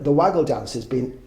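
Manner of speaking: ordinary talk, nothing marked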